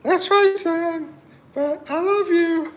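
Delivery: high falsetto mom comforting her son; high falsetto mom comforting her heartbroken son; mom's reply voice. Pretend. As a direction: soothe him like a doting mom, way up high. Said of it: "Right, Mom?".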